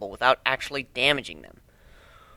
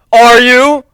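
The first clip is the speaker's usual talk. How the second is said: much higher, loud pushy jeer; jeering bully; 11 semitones higher, 16 dB louder than usual. Acted. Bully pressing the beaten narrator, high loud jeer. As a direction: push the taunt up high and hard. Bully repeats taunt, louder.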